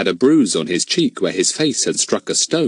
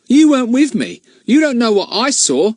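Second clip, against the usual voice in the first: put-on higher, louder huffy boy; annoyed; 6 dB louder than usual. Ern tells Larry he saw it, high and annoyed. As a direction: stand your ground, peeved. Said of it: "looking annoyed".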